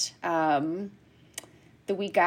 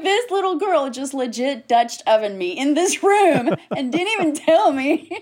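high, loud, laughing indignation; mock outrage; 7 semitones higher, 6 dB louder than usual. Nurse pranked by a little girl, mock outrage. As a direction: exclaim it, scandalized and amused.

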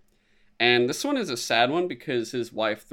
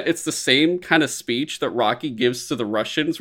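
usual speaking voice

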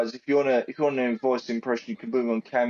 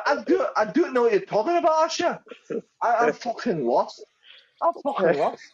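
high, lost Scottish woman; bewildered mock-Scottish pleading, pitched up; Angie; 8 semitones higher than usual. Retelling Angie lost in Asher's spiel, high Scottish accent. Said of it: "I can't do it Scottish, but she's like".

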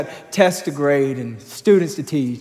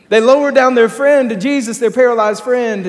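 higher and louder preached climax; preaching at full force; 7 semitones higher, 7 dB louder than usual. Real, not acted. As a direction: hammer it home from the pulpit. Preaching peak.

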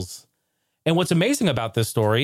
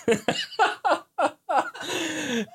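real laugh pitched up; high helpless laughter; 13 semitones higher, 4 dB quieter than usual. Real, not acted. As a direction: crack up high and long. Laughing at the memory.

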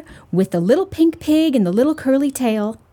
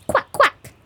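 high, short duck quacks; quacking duck; 13 semitones higher than usual. A narrator's reading. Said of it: "answered the duck".

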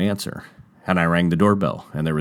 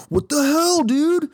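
mock angry bro; slower than usual. James confronting the new kid, high mock outrage. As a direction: lightly ham an angry skater. James shoving him angrily.

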